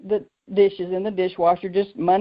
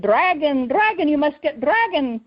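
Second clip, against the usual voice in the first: higher, urgent imitation of a Ugandan accent; 6 semitones higher than usual. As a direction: insist like an eager Ugandan doctor, accent and all. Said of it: "he sure does have a funny accent".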